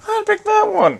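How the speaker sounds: very high, squeaky little voice; silly high-pitched commentary; put-on character voice. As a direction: pipe it up high like a puppet. Same put-on voice choosing.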